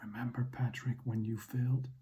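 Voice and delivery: hushed, taunting inner whisper; whispering to oneself. Acted. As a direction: whisper it to yourself like a nagging memory. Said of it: "I'm whispering to myself".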